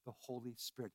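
a gentle blessing, softly breathed; soft breathy quote. Retold or imitated. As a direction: hush it out as Jesus. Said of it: "breathed on the disciples and said".

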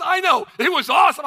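enjoy the thrill, like it was a show; Rick's cheerful reply. Retold or imitated. Retold friend Rick's cheerful reply.

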